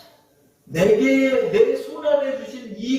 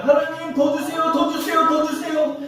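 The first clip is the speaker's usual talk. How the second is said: much higher, whiny begging of God; greedy prayer; 9 semitones higher than usual. Retold or imitated. Pastor mocking people who pray for more, high and whiny. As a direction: plead like a greedy child. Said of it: "막 이런 기도하는 사람 많아요".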